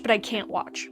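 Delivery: ordinary talk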